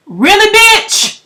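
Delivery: high, slow, sarcastic disbelief; incredulous. Retold or imitated. Storyteller mocking the uncle's message, high and slow. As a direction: drag it out, outraged and mocking. Same reaction repeated.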